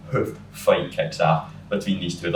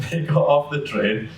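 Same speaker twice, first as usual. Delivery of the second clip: laughing; 8 semitones higher than usual. Real, not acted. Finishing the story to a friend, laughing. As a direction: giggle the line out.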